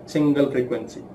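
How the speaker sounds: ordinary talk, nothing marked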